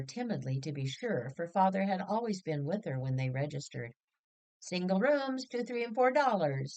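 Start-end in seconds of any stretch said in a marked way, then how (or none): 4.6-6.8 higher, brisk and breezy price list; jaunty clerk. Acted. Hotel clerk quoting room prices, jaunty. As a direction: rattle off the rates with a jaunty lilt. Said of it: "said the jaunty clerk".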